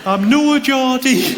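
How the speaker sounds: high, drawn-out North East protest; terrible Geordie accent. Acted. Barmaid repeats her denial, slow exaggerated North East accent. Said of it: "Sorry, that's a terrible accent".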